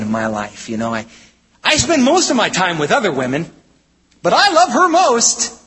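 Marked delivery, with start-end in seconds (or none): 1.6-5.6 higher-pitched husband's excuse, rising on the claim of love; mock protesting husband. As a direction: plead an absurd excuse, voice climbing high. Voices an absurd husband's excuse.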